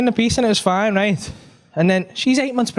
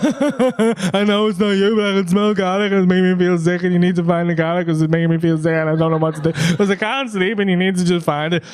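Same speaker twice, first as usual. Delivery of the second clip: mock weeping wife. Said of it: "Uncontrollably weeping".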